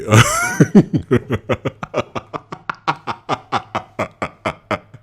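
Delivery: laugh. Real, not acted. Laughing at own impression.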